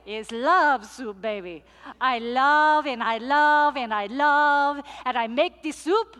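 slow, loud and proud; booming declaration. Retold or imitated. Luisa tells pastor about her soup, slow and loud. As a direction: proclaim it like a creed.

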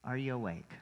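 usual speaking voice

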